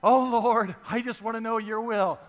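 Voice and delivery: teasing imitation of how we pray; mock pious prayer. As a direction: ham up an insincere plea to God. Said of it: "that's the way we pray".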